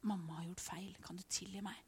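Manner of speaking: a mother's hushed plea for forgiveness; quiet, tender apology; gentle whispered apology. Shown as a demonstration. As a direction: whisper it softly to the child. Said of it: "og si".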